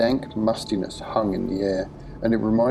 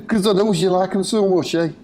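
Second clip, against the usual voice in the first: high, loud taunt broken by cackles; giggling madman; 10 semitones higher, 4 dB louder than usual. A narrator's reading. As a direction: jeer and burst into crazed laughter. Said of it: "in between giggles".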